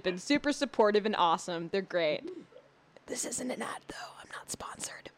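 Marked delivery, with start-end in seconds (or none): 3.1-5.1 hushed, whispered disclaimer; whispered aside. Pretend. Host slipping listeners a disclaimer, whispered.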